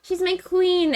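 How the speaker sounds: high-pitched put-on swooning voice; mock adoration. Voicing lovesick fawning over Miss Anna.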